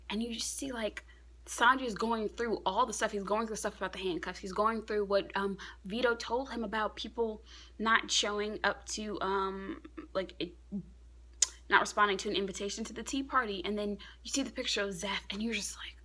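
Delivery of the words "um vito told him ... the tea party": ordinary delivery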